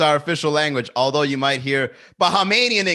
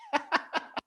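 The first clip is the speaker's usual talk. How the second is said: a quick airy giggle, pitched up; high breathless giggle; 11 semitones higher, 7 dB quieter than usual. Real, not acted. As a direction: let a short unvoiced laugh escape. Amused by drunk lyrics.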